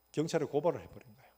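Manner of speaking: ordinary talk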